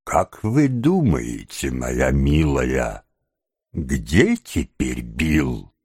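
put-on slow, wheedling old schemer; oily, drawn-out fawning question; ingratiating. A narrator's reading. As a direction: coax sweetly, drawing the words out. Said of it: "спросил самым заискивающим тоном".

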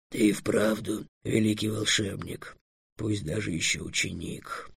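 low, slow, very soft mutter; muttering dragon. A narrator's reading. Dragon conceding Trix is a great wizard, muttering. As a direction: mumble it low, half to yourself. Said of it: "пробормотал дракон".

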